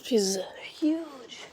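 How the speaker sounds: whispered aside. Real, not acted. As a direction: breathe it out, unvoiced.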